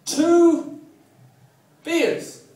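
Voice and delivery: very high, loud holler; mock tourist ordering. English tourist trying to order in France, mock calling out. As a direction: call out each word separately, long and high. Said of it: "Try that in France".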